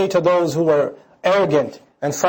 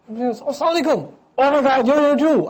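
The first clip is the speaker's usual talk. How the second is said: excited greeting; 6 semitones higher than usual. Acted.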